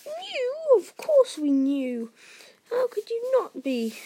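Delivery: very high, loud outburst; shrieking aunt. A narrator's reading. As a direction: shriek it with bitter scorn. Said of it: "shrieked Aunt Petunia suddenly".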